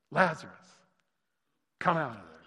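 calling out to the dead man, loud. A narrator's reading. Preacher voicing Jesus calling Lazarus from the tomb, loud call. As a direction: cry his name out strongly, then drop. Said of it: "he cried out with a loud voice".

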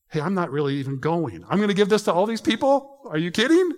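retold alarm over handing out the cash; incredulous outburst. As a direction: throw it back at him, shocked he means it. Retelling his alarm at the plan.

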